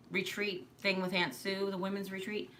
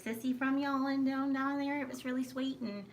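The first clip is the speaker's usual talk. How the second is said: lilting mock Georgia accent, higher than usual; 5 semitones higher than usual. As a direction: imitate a sweet Georgia accent, pitched up. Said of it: "talking just like".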